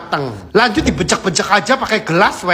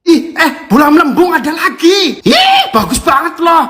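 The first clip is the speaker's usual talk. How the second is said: excited; 9 semitones higher than usual. Real, not acted. Excited reaction to food clip.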